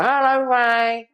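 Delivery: high, slow, sing-song "Hello, Ray" in a mocking female voice; high mock feminine voice. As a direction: coo it up high like a flirty woman. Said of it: "it's going".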